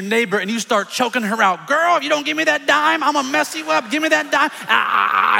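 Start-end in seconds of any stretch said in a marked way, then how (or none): 1.7-4.5 high-pitched, hammed-up outrage; mock angry threat. Gigi choking her neighbor over a dime, hammed-up fury. 4.7-5.4 drawn-out strangling cry; mock choking yell. Acting out choking the neighbor, mock strangled yell.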